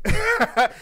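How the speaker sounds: loud high laugh; high burst of laughter. Real, not acted. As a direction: break into a big laugh. Laughing at own story.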